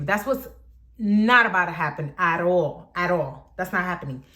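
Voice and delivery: ordinary talk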